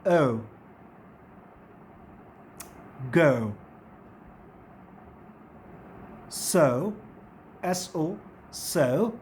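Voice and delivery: ओ and go low and slow, uh gliding to oo; teacher modelling "go"; diphthong demo. Shown as a demonstration. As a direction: draw out ओ from uh into oo. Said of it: "pronunciation क्या है".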